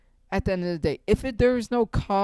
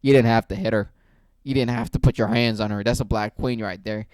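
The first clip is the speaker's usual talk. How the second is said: deeper, louder, pleading mock peacemaker; mock gentle peacemaker; 7 semitones lower, 4 dB louder than usual. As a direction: ham up a preachy do-gooder stepping in. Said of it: "you think I'm gonna step in like".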